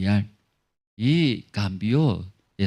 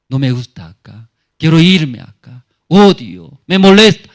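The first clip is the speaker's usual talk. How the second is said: resentful complaining; 11 dB louder than usual. Acted. Young woman's inner complaints voiced.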